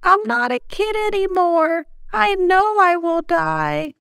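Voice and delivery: hammed-up doomed kid; mock-tragic child insisting he'll die; dramatic child.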